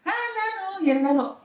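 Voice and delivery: very high, squealing mock call of the nickname; mocking cartoon shriek. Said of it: "pero así". (chillido agudo de burla)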